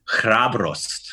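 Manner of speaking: hrabrost with both r's rolled. Shown as a demonstration. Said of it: "you got two rolling R's in there".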